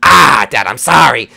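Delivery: loud burst of mock apology; protesting son character. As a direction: cry out like a kid caught by Dad. Son caught by dad, apologises.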